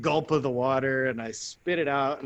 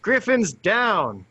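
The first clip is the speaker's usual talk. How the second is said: slow, high, loud call; yelling out; 8 semitones higher, 7 dB louder, much slower than usual. Retold or imitated. Buddy alerting the squad that Griffin fell, yelling. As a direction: call it out across the field. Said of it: "he yells out".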